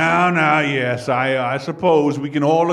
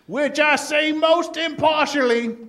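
pitched-up ironic aside; sarcastic aside; 8 semitones higher than usual. Acted. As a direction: slip it in with mock innocence. Biased judge mock aside.

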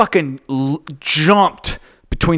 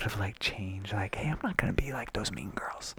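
hushed whisper; 6 semitones lower, 19 dB quieter than usual. Retold or imitated. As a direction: breathe it like a secret. Whispered aside about celebrity.

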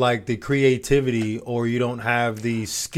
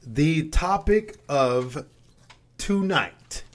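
very slow, teasing build-up; dramatic announcement; 4 semitones higher, much slower than usual. Real, not acted. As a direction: drag out the big reveal. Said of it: "drum roll please".

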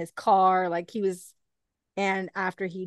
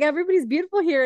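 gushing quote; 8 semitones higher, 7 dB louder, faster than usual. Retold or imitated. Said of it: "And I'm like".

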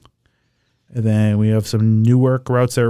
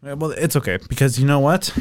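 ordinary delivery

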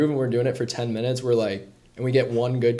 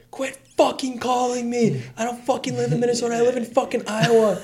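imitated angry yelling caller; 10 semitones higher than usual. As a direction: mimic the angry guy, pitched way up. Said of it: "he basically was screaming at me".

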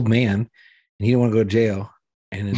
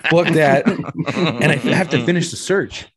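laughing through the words, pitched up; giggling disbelief, higher and louder; 7 semitones higher, 3 dB louder, slower than usual. Real, not acted. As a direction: crack up while telling it. Laughing while retelling the shit.

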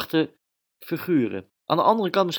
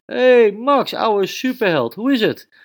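high, loud, hearty hello; dad breezing in with a glass of wine; father greets his son at the table, high and loud; cheerful father greeting; 11 semitones higher, 7 dB louder than usual. Acted.

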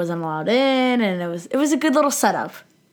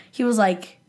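ordinary talk, nothing marked

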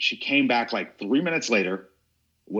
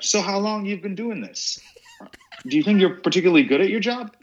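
high, breezy mock small talk; fake friendly chat; 10 semitones higher, 3 dB louder than usual. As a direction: chat sweetly as if nothing happened. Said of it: "talking to her as if we weren't having a confrontation".

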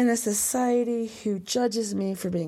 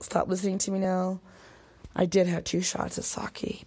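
ordinary delivery

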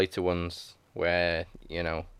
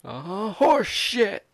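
hammed-up quote; 12 semitones higher, much slower than usual. Movie quote.

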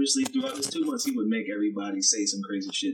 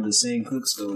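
normal talking voice

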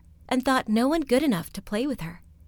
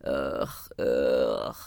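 very low grumbled ugh ugh; deep rumbling grunt; grumbling ugh. Acted. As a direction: grunt like an old stone wall. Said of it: "grumbled the wall".

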